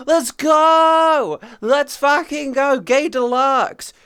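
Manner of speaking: high, loud rallying cry; hype cheer. Real, not acted. Streamer hyping up chat with a loud cheer. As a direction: shout it like a crowd chant.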